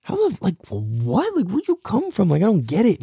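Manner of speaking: high, bewildered retelling of being stunned; amazed disbelief. Retold or imitated. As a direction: act baffled by your brother's smarts. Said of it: "I was just like".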